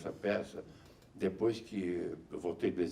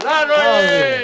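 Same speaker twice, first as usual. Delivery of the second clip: slow, stretched shouted chant; drawn-out shouted call; 19 dB louder, much slower than usual. Real, not acted. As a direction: hold the call long and loud. (chamado arrastado e gritado)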